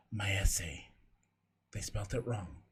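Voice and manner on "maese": whisper. Acted. Whispered name in story.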